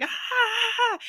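high, loud whoops; playful mock shout; loud hype yelling. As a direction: hype it up like a rowdy crowd. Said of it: "talking real loud and be like".

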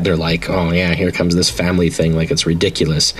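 ordinary talk

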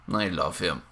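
soft tender whisper-like; overwhelmed by cuteness. Real, not acted. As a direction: gush quietly over the pet. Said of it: "That's that cute aggression again".

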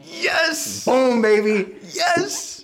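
high, excited victory shout; excited cheer. Real, not acted. Wins the count, cheering.

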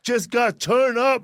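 loud, high, drawn-out podcast greeting; stretched-out host holler; hyped show opener. Real, not acted. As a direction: kick off the show big and slow. Host shouts the podcast's opening greeting.

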